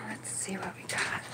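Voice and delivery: soft unvoiced whisper. Real, not acted. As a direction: whisper so nobody hears. Whispering at the dumpster.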